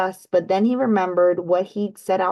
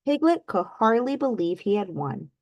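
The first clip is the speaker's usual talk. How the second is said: usual speaking voice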